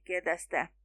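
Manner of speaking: ordinary delivery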